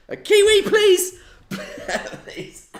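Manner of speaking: high, loud exasperated plea; pleading with dog. Real, not acted. Host pleading with the dog Kiwi, loud. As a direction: call the dog off, laughing in disbelief. Scolding the dog mid-stream.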